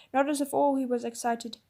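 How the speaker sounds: ordinary delivery, nothing marked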